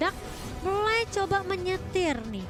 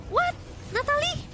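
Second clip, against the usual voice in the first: surprised; 10 semitones higher than usual. Real, not acted. Caster surprised by hero appearing.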